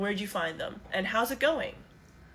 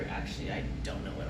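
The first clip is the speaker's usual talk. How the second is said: whispered; 5 dB quieter, faster than usual. Real, not acted. Unvoiced slow admission.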